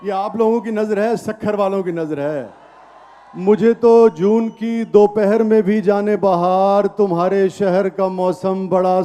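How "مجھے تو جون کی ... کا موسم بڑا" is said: measured, emphatic verse recitation; poetry recital. Acted. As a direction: recite it to a live audience. Recites ghazal at mushaira.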